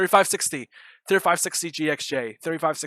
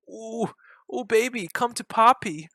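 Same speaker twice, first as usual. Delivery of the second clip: high, eager coo at the price chart; gleeful coaxing; 7 semitones higher than usual. Real, not acted. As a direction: lure the price in like a pet. Price dropping toward his target.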